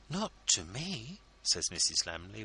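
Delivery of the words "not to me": deep feeling. A narrator's reading. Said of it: "with deep feeling".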